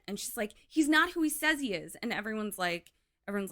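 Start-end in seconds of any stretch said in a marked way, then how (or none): 0.7-2.0 high, loud reveal; loud accusation. Retold or imitated. Daughter exposing Dan to the family, loud and high. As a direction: point the finger and raise your voice.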